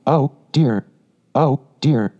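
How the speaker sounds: mildly exasperated despair. A narrator's reading. Rat despairing at the mole's obtuseness, despairing. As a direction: lament it in two separate sighing phrases. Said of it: "in despair at his obtuseness".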